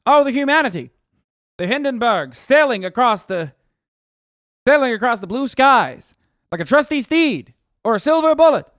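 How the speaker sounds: high, loud, hammed-up newsreel announcer; mock old-time announcer. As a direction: ham it up like an old radio reporter. Parodying the Hindenburg radio announcer.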